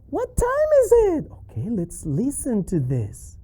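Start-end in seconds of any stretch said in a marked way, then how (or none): 0.1-1.3 very high-pitched puppet voice; piping cartoon voice; squeaky puppet. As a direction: answer as a squeaky little character. Puppet Charlie interrupts with question.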